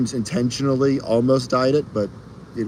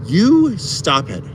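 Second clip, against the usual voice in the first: slow, loud, high mock scold; 7 semitones higher, 5 dB louder, much slower than usual. Scolding a chatter in fun, slow loud mock rebuke. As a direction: drag it out like a playful telling-off.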